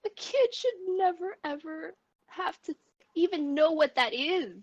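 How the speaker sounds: high, tearful voice; choked up and heartbroken; real crying, emotional. Real, not acted. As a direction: talk through rising sobs, voice climbing high. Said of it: "I'm so emotional right now".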